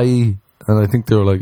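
ordinary talk

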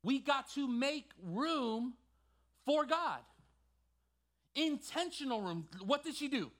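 high, emphatic pulpit delivery; impassioned preaching. Acted. Preacher telling church to make room for God, fervent preaching. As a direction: preach it hard, punching the key phrases.

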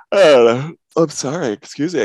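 talking through a yawn; stifle a yawn mid-sentence. Real, not acted. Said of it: "I keep yawning".

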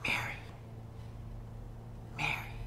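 soft, whispered calling of her name. Acted. Jesus softly calling Mary by name. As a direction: breathe her name gently.

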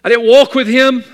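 shouted high, in full preaching cry. Real, not acted. Preacher to his congregation, shouting. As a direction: bring it out in a booming preacher's cry.

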